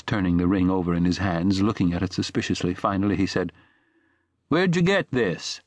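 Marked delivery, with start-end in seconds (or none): 4.5-5.6 higher, slow, wary voiced shopkeeper; a hint of a distrustful shopkeeper; suspicious question. A narrator's reading. Shopkeeper questions the stranger about the ring, suspicious. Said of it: "The way the man said it made his breath choke".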